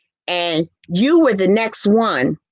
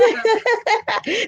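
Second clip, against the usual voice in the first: high squealing laugh. Real, not acted. Laughing at her own joke.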